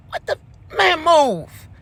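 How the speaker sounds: high, then loud, move; irritated shove-off. Retold or imitated. Woman brushes off staring fiance, high and irritated. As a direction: push him away annoyed. Shoving fiance out of her face.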